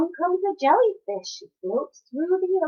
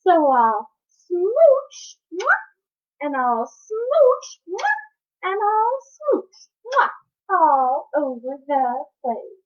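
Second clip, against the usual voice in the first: very slow, loud, with smacking kisses; slow smooching finale; 4 semitones higher, 6 dB louder, much slower than usual. Acted. Happy fish ends the story, slow smooches and kisses. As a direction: stretch every smooch out.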